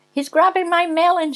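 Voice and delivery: high put-on lady's yell; lady screaming. A narrator's reading. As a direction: cry out as an outraged lady. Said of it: "she screams".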